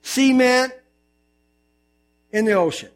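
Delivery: usual speaking voice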